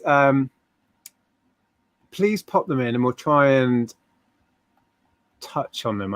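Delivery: ordinary talk, nothing marked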